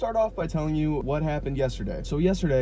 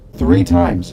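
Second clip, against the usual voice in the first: much louder and much lower, punching THREE; exasperated shout; 8 semitones lower, 11 dB louder than usual. Real, not acted. Venting to viewers about endless walk-throughs, loud exasperated shout. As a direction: yell the count out in disbelief.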